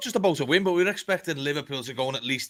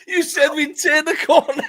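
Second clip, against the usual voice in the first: higher and louder, words breaking into laughter; laughing; 14 semitones higher, 4 dB louder than usual. Real, not acted. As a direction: tease him through real laughter. Mocking friend's failed prediction.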